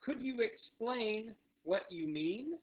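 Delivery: drawn-out example question; phrase model. Shown as a demonstration. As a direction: model it slowly and clearly. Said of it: "same phrase could be".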